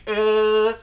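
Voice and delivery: a short central "uh" to show the formant; neutral uh vowel. Shown as a demonstration. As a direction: say "uh" with a relaxed mouth and the tongue in the middle. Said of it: "that's a different format than".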